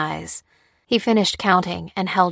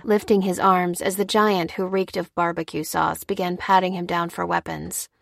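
ordinary delivery, nothing marked

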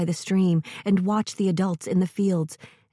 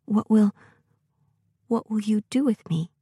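slight tremor; 3 semitones higher than usual. Acted. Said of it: "only a slight tremor in his voice".

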